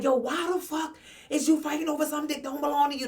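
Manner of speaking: fast, heated taunt; heated callout. Retold or imitated. Yelling at the side chick up the street, heated. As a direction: tell her off while marching up. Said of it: "I'm like".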